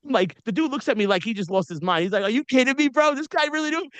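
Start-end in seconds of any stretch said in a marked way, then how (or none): 2.2-3.9 a stunned doorman who can't believe it; incredulous bouncer. Retold or imitated. Bouncer reacting to the headliner vouching for him, incredulous and higher.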